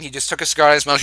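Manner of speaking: ordinary talk, nothing marked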